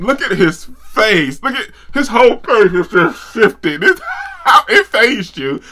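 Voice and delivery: high, loud words through laughter; laughing. Real, not acted. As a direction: cackle while pointing it out. Laughing at character's face.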